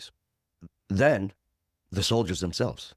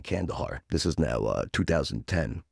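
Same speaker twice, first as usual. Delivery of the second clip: usual speaking voice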